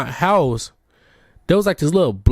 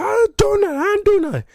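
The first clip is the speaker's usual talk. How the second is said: high, yelled denial; angry protest. Retold or imitated. Boy in timeout yelling at accusing classmates, angry. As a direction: protest your innocence, pitched way up. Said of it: "And I get mad".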